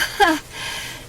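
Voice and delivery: breathy embarrassed little laugh; a caught-out laugh before explaining; nervous laugh. Acted. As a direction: laugh it off awkwardly. Awkward question from boss.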